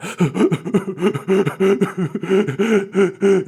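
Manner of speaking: high, loud choked sobbing gasps; imitated crying gasps. Shown as a demonstration. Showing how his held-back crying sounded, loud gasping sobs. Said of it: "it sounds like that".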